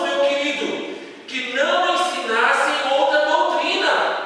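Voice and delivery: slow, loud, half-sung Bible reading; solemn scripture reading. Acted. Pastor reading First Timothy to the church, slow and loud. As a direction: intone the verse slowly like a preacher. Preacher reads the verse aloud.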